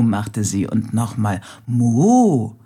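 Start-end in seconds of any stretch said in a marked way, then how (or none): 1.7-2.6 a loud happy cow's moo; joyful moo again. Acted. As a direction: moo once more with joy. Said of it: "Das war toll". (fröhliches lautes Muhen)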